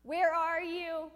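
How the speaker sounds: loud, high, drawn-out plea; crying out to God. Retold or imitated. David crying out to God, slow loud plea. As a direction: plead slowly upward. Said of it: "David's crying out to God".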